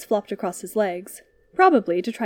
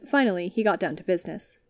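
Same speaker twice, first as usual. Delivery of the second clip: ordinary delivery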